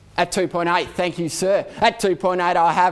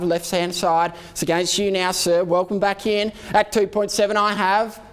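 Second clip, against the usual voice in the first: normal talking voice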